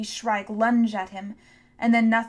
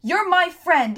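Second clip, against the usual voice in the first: high, loud pleading shout; 8 semitones higher, 10 dB louder, slower than usual. A narrator's reading. Tom shouts to Hester, loud plea. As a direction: shout it to reach her. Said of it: "he shouted".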